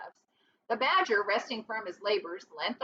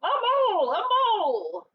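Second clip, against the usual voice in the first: much higher, loud shout; war cry; 11 semitones higher, 7 dB louder than usual. Acted. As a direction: bellow it as you charge. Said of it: "shouting his awful war cry".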